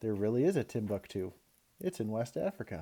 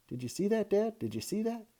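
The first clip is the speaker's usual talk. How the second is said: much higher, eager questions; amazed excitement; 10 semitones higher than usual. A narrator's reading. Boy amazed, asking dad if he saw. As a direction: burst out with amazement.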